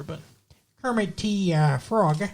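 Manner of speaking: normal talking voice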